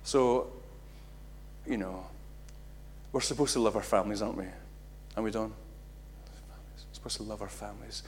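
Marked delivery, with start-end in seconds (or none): 5.5-8.0 soft, slow whisper; breathy confiding whisper; whispered aside. Real, not acted. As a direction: lean in and whisper it.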